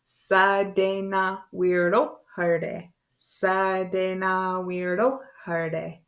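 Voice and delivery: "Sa de na weirdo herde" slow, chanted on an even note; broken-down demonstration. Shown as a demonstration. Teacher breaking down a long word, slow even chant. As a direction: stretch "Sa de na weirdo herde" into steady, measured syllables. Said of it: "let's break it down a little bit".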